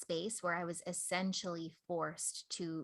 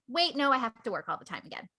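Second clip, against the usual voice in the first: higher, louder, hurried self-protest; retold urgent objection; 5 semitones higher, 6 dB louder, faster than usual. Woman recalling going back to overworking, urgent retold objection. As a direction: jump in quickly, raising pitch and volume. Voicing her own backsliding panic.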